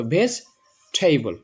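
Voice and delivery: ভেজিটেবল slowly split, "veis... theibol"; correct pronunciation. Shown as a demonstration. Teacher modelling the correct form, ভেজিটেবল as slow "veis... theibol". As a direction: drop the middle vowel of ভেজিটেবল, aspirate the t: "veis... theibol". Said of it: "সেখানে ঠ বলবো".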